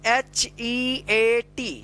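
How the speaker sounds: letters drawn out one by one; stretched, separate letters; slow spelling. Shown as a demonstration. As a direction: spell it slowly for beginners. Teacher spells out word for learners.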